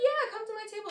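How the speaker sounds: very high-pitched reassuring invite; acted insistent invitation. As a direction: re-enact waving someone over, voice way up. Acting her insistent invitation.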